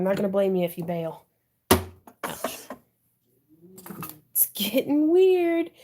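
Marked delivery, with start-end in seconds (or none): 4.3-5.8 high, loud slow laugh. Real, not acted. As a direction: laugh at yourself, giggling. Laughing at her own noises.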